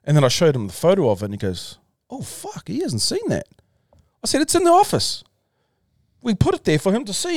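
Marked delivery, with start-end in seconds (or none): 2.1-3.5 high, hushed startled gasp of alarm. Retold or imitated. Team member realising [name] missed the canvas, high hushed alarm. 4.4-7.3 high, pushed insistence; urging, voice way up; exasperated. Retold or imitated. Telling the team member where the canvas is, high and exasperated.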